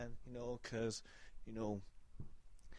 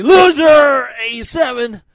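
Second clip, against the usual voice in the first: high loud call; shouted name; 8 semitones higher, 15 dB louder than usual. Acted. Host shouting out a listener's buddy, loud call. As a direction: call the name out like a hype man.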